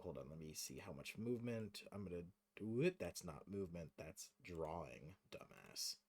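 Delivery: low, very soft self-talk trailing away; muttering to oneself. Real, not acted. Game master chiding himself over the map tool, quiet muttering. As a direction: grumble at yourself under your breath. Scolding himself while measuring movement.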